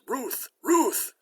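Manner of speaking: very high barked words; two high woofs shaped as a name; talking dog. Acted. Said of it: "Dog says".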